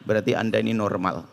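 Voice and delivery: ordinary delivery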